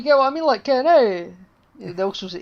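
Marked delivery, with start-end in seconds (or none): 0.6-1.3 loud, high northern Scottish call; mock northern Scottish exclamation; northern Scottish accent. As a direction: belt out an ironic northern Scots accent. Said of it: "an accent from the north".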